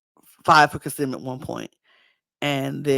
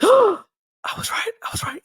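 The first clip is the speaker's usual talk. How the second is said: high gasped, half-whispered realisation; mock astonishment; 5 dB louder, faster than usual. Joe believing he guessed correctly, mock gasping astonishment. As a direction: imitate stunned self-vindication, high and hushed.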